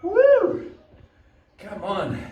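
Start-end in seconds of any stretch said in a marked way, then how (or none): 0.0-0.7 high, loud cheer; excited whoop. Real, not acted. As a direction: let out a big whoop. Cheering during hard workout.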